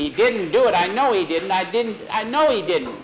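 half-screamed insistence, narrated not full out; mock screaming. A narrator's reading. Boy insisting his dog is innocent, mildly screamed. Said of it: "Jackie kept screaming".

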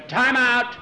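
high, loud call; urgent courtside holler; calling timeout. A narrator's reading. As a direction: shout it to stop the game. Big player calls for timeout.

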